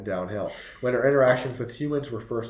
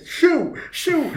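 high laughing shout; shooing shout; 6 dB louder than usual. Retold or imitated. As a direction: shoo it away, high and loud. Staff shooing otter away.

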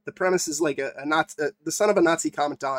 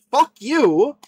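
sudden higher angry shout; angry outburst; 7 semitones higher, 4 dB louder than usual. Real, not acted. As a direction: spit it out in disgust. Angry rant at the film.

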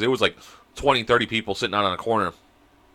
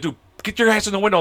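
higher-pitched urgent quote; urgent retold plea; 8 semitones higher than usual. Friend pulling him back into the car, urgent. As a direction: plead as if tugging a friend back inside.

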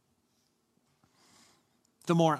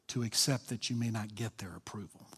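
whisper; 5 semitones lower, 8 dB quieter than usual. Real, not acted. Sermon point whispered.

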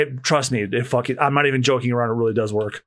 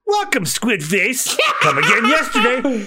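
laughing mock greeting. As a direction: greet him sweetly while cracking up. Waiter insults customer, laughing.

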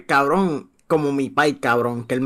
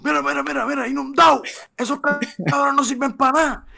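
high, mocking imitation of his father gloating; mocking dad; 10 semitones higher than usual. Imita al padre burlándose de EE.UU. (imitando al padre burlón, voz muy aguda)

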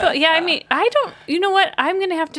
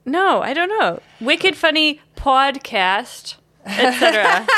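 usual speaking voice

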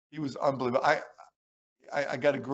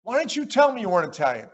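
higher, louder, fast imitation of an affronted coach; mock indignation; 7 semitones higher, 5 dB louder, faster than usual. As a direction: demand an explanation in mock outrage. Coach retold, demanding an explanation.

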